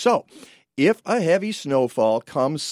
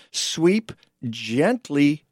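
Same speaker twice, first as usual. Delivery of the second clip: slow and gentle; much slower than usual. Shown as a demonstration. Radio host to listeners, slow gentle emphasis. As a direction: ease each word out slowly, like soothing. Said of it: "Am I saying the word gently enough?".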